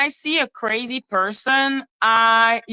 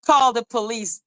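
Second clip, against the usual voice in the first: usual speaking voice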